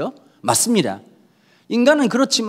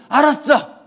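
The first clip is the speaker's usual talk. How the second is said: curt Gyeongsang reply; 7 semitones higher, slower than usual. Acted. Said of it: "경상도 기질".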